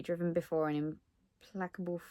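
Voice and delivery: cat fur in the mouth; mouth full. Real, not acted. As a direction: talk round something in your mouth. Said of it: "I've got cat fur in my mouth".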